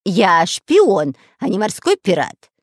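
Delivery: loud, indignant protest; hurt pride, voiced forcefully; offended. A narrator's reading. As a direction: protest as if insulted. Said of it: "обиделся Дырка".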